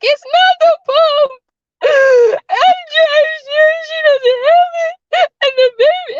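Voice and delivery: high, tearful voice breaking into sobs; retold bawling.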